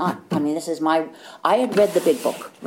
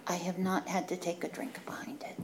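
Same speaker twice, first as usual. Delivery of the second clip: 1 hushed admission; 13 dB quieter than usual. Real, not acted. Quiet close of share.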